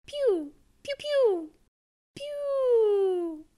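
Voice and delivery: squeaky laser blasts; high laser pews. Acted. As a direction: zap it like a toy laser. Robot monkey laser sounds.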